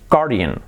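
guardian as GAR-dee-an; pronunciación correcta. Shown as a demonstration. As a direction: drop the u in "guardian", stress first syllable. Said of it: "Pues se dice". (guardian bien dicho, 'gár-dian')

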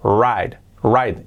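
"Ride" with an English diphthong "rait"; "ride" said like "right". Shown as a demonstration. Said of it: "la pronunciación es right".

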